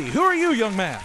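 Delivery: high, loud mock-grand question; theatrical introduction cue. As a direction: boom it out to cue your co-host. Host theatrically cues co-host introduction.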